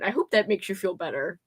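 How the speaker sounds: ordinary delivery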